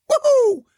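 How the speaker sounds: a silly triumphant whoop for mom; science noise woohoo. Shown as a demonstration. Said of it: "Like when you tell your mom".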